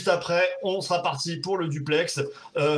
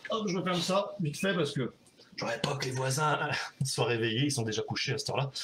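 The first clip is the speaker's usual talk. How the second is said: softer, lowered voice for the neighbours; hushed; 4 semitones lower, 5 dB quieter than usual. Real, not acted. Host mindful of sleeping neighbours, hushed aside. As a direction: drop low and soft so nobody wakes.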